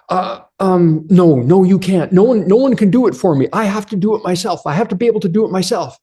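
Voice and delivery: high, fast, flustered voice of a man who can't pour; anxious stuck man. Retold or imitated. Man who can't pour the coffee, refusing help in a high flustered voice. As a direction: play a nervous guy insisting he must do it himself.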